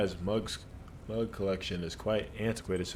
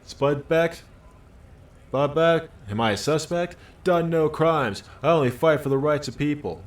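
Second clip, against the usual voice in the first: loud, higher, indignant questions; the worker getting louder and offended; defensive worker; 6 semitones higher, 7 dB louder than usual. Acted. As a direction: bristle at being called a suspect.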